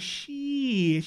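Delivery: loud, high, stretched "sheee-it"; imitating a TV dude milking the word; long falling mock drawl on the cuss; drawn-out TV-character cussing. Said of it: "He said".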